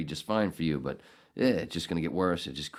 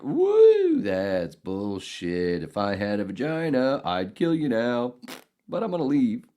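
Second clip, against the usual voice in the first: retold outburst; 5 semitones higher, 5 dB louder than usual. Retelling what he said in class.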